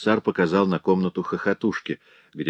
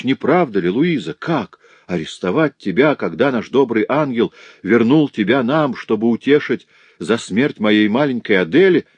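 raised, insistent voice of a distraught father; agitated protest; 3 semitones higher, 4 dB louder than usual. Acted. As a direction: protest passionately, pitch pushed up. Father protests his daughter's arrest. (взволнованно, с горячим протестом)

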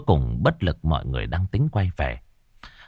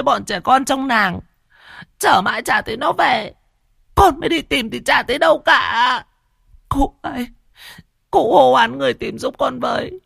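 a mother begging through sobs; wailing mother. Acted. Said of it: "Mụ Hoa mới mếu máo". (mụ Hoa mếu máo, kêu khóc van xin cụ Long)

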